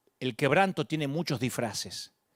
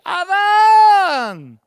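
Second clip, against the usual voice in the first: much higher, much louder crying call; God calling Adam in sorrow, tearful loud cry; sad call; 13 dB louder than usual. Pretend. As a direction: cry out his name in grief. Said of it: "la voz sonó triste".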